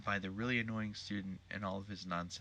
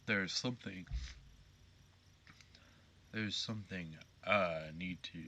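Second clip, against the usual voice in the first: Colonel accent. Shown as a demonstration. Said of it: "nope, that's Colonel Sanders".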